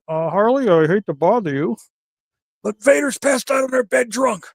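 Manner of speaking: imitating himself waking Harley with the news; timid phone call. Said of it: "I'm like".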